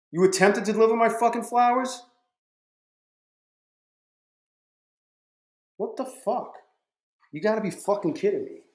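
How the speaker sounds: high, incredulous angry outburst; exploding in disbelief at the delivery; raging at the missed delivery; real outrage. Real, not acted. Furious reaction to failed flower delivery.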